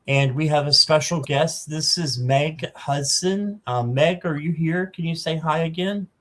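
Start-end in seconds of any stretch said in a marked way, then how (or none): none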